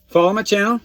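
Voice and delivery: upbeat plug. Real, not acted. Creator's sign-off plug.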